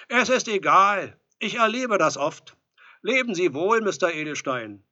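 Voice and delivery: loud, high parting words; offended farewell. A narrator's reading. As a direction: call goodbye through the door, hurt. Offended visitor says goodbye.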